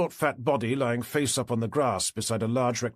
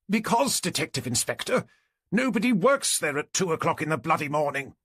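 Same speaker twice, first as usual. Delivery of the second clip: high-pitched exasperated complainant; exasperated neighbour; 6 semitones higher than usual. A narrator's reading. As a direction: jump high, indignant at the police.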